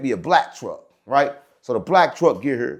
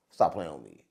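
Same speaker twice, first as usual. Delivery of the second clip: much lower and softer, under the breath; muttered aside; 10 semitones lower, 10 dB quieter than usual. Real, not acted. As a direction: mumble it to yourself.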